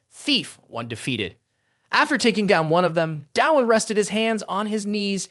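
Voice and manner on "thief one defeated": low, slow, distant system announcement; a game system calling out the result, slow and low; the game system announcing a kill. A narrator's reading. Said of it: "The system chimed in".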